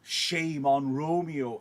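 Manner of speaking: paraphrased nurse. Retold or imitated. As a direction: drag out the nurse's scorn. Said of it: "So nurse joins in and says".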